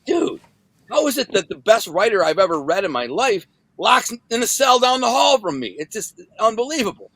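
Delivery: high-pitched, awestruck reader reaction; acting out an astonished fan; retold amazement. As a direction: marvel at it, pitch swooping up. Voicing amazed readers' praise.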